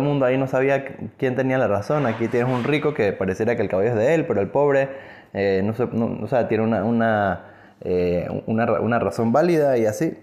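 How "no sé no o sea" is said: normal talking voice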